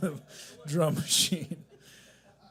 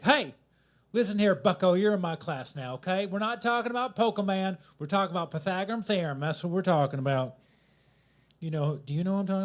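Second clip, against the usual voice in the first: loud, higher southern math teacher; southern accent; 6 semitones higher, 7 dB louder, faster than usual. Retold or imitated. Comic imitating his old math teacher, loud southern accent. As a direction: bark it like a Southern teacher scolding class. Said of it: "who had the most southern accent".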